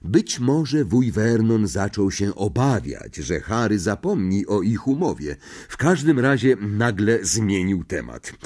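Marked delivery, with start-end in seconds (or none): none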